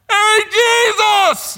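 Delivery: high, drawn-out shout across a distance; distant yell. Acted. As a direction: holler slowly as if calling someone far away. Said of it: "you don't hear them yelling at each other".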